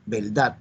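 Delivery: "Verdad" as "veldad", r turned to l; Boricua-style "Verdad", said veldad; Puerto Rican l-for-r. Shown as a demonstration. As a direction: repeat "Verdad" with an l before the d. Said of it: "El boricua dijo".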